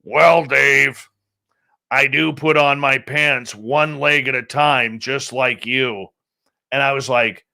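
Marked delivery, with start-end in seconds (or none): 0.0-6.1 gruff, deliberate voice, slowing as it goes; Stanton Friedman. Retold or imitated. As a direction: imitate a gruff, drawn-out way of talking. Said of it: "in that gruff voice".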